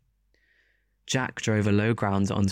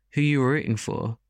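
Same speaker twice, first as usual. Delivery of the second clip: higher-pitched, mildly angry question; angry challenge; 5 semitones higher than usual. A narrator's reading. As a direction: throw it out as a challenge. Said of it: "I asked him angrily".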